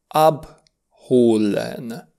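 abholen stretched very slowly, syllable by syllable; very slow model word. Shown as a demonstration. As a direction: draw out ab-ho-len for learners. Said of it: "se pronunță".

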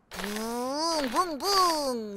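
slow low vroom; drawn-out engine hum; engine noise imitation.

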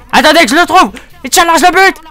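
high, loud threat yelled; excited shout. Real, not acted. Streamer yelling at a cop in the game, high and loud. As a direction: yell it mid-fight.

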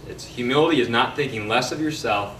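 ordinary delivery

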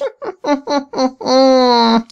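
high villain cackle; gloating cartoon-villain cackle; evil laugh. Evil guy laughs at threat.

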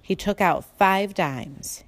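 usual speaking voice

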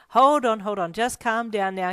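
plain reading voice

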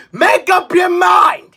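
yelling at the person holding up the ticket line; angry shout. Real, not acted. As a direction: bark the order at them. Yelling at indecisive moviegoers.

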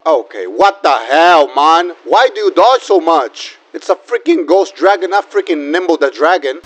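loud, high-pitched indignant complaint; exasperated rant. Real, not acted. Gamer berating the ghost dragon for dodging, exasperated.

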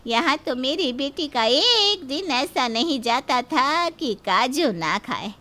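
ordinary talk

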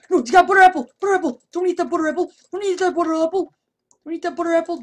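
high loud shouted warning; loud urgent repeated shouts; frantic shouting. Real, not acted. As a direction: yell to stop him eating. Enemy about to eat apple.

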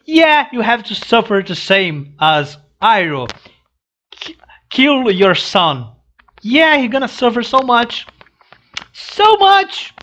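a lightly voiced Azula, savouring it; half-hammed gloating impression; mock Azula taunt. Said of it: "Azula to say".

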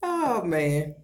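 usual speaking voice

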